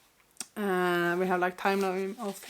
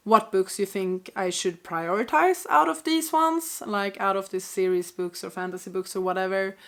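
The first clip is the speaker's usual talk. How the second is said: ordinary talk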